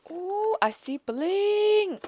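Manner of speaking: high, slow, loud gasp and coo; thrilled gasp and squeal; delighted gasp. Real, not acted. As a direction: gasp then coo over the bling. Spotting sparkly gift.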